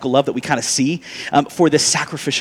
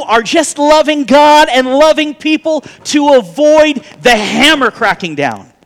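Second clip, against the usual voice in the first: higher, louder preaching swell; fervent preaching; 10 semitones higher, 6 dB louder than usual. Real, not acted. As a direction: preach it with rising fervor. Preaching at full force.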